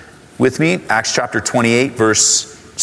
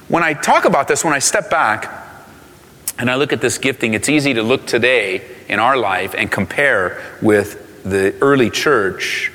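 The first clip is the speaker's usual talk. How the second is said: usual speaking voice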